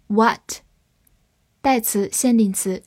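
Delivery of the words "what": American "what" with an open unrounded vowel; American accent. Shown as a demonstration. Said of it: "美式发音".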